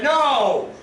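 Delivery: sharp refusal. Acted. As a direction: snap the refusal. Refusing the offered pen.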